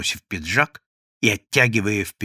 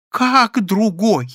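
slow, high, loud outcry; indignant cry; 9 semitones higher, 5 dB louder, much slower than usual. A narrator's reading. Прозрачный cries out at the news, slow and high. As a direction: cry out in disbelief. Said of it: "закричал Прозрачный".

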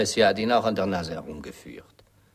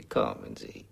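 breathy whispered, much lower and softer; whispered coaxing; 11 semitones lower, 6 dB quieter than usual. Acted. Morton whispering to coax Biralbo, soft and low. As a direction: lean in and whisper it.

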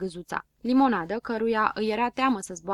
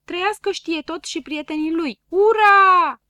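higher, louder triumphant hurrah; cheering shout; 8 semitones higher, 10 dB louder than usual. A narrator's reading. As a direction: cheer the heroes. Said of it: "strigară piticii". (piticii aclamă pe Știetot, higher and louder)

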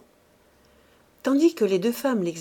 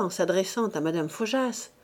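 usual speaking voice

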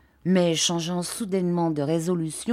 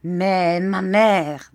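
slow, louder, seething; furious; 5 dB louder, much slower than usual. A narrator's reading. Uriah turning on his mother in slow, louder fury. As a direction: drag it out in contained fury. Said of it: "dit-il d'un air de fureur".

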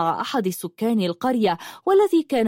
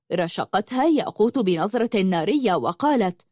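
plain reading voice

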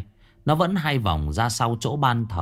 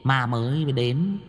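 campy cackling drag queen; 2 semitones higher, slower than usual. A narrator's reading. Violet teasing the new ghost, campy and amused. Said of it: "cười khành khạch".

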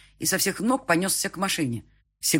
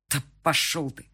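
gritted-teeth retort; 3 dB louder than usual. A narrator's reading. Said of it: "процедил я".

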